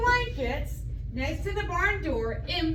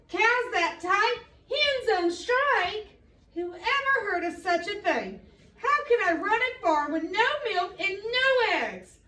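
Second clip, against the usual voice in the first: furious; 6 semitones higher than usual. A narrator's reading. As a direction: rant at the absurd animals. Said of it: "Farmer Brown was furious".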